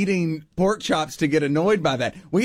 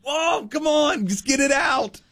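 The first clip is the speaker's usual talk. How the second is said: shouting; 11 semitones higher than usual. Retold or imitated. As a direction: yell at the cat to get it over with. Coaxing the cat to barf.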